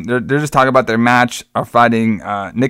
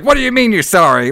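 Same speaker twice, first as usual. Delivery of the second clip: mock freak-out; 8 semitones higher than usual. Wrestlers freak out.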